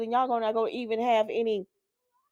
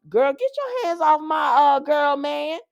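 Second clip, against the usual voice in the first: higher, louder call-out; telling Holly to stop touching the man; mock scolding; 6 semitones higher, 5 dB louder than usual. Real, not acted. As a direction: warn her off with sass.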